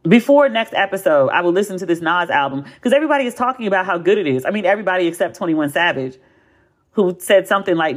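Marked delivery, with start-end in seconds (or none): none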